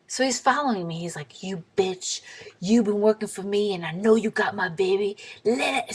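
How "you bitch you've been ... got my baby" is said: normal talking voice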